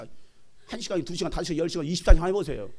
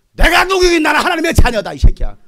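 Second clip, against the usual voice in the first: defiant shout; 8 semitones higher, 13 dB louder than usual. Acted. Preacher answering back a demon, defiant shout. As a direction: stand your ground angrily.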